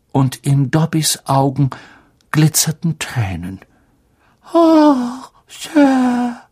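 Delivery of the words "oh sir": groaning. Acted. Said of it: "stöhnte er".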